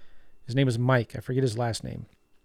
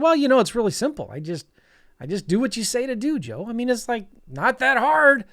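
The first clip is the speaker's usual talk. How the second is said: put-on higher-pitched take on a Southern boy; Greg; 8 semitones higher than usual. Southern student explains his success to his teacher, imitated casual ease. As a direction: imitate a good old boy making it sound easy. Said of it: "He's got a Southern accent".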